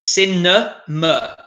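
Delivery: "Cinema" slowly as SIN-uh, muh; drawn-out teacher's "Cinema"; syllable breakdown. Shown as a demonstration. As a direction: break "Cinema" up, two schwa sounds. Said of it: "Two quite similar phonetic sounds there".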